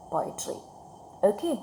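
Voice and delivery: usual speaking voice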